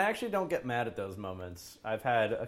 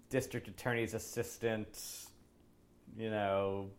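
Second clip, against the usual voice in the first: ordinary delivery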